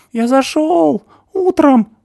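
high, loud hysterical accusations; panicked screaming; hysterical shouting. A narrator's reading. Stasik screaming confession.

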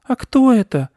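put-on high-pitched small girl, surprised. A narrator's reading. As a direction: ask in a squeaky, puzzled child voice. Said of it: "удивилась Анечка".

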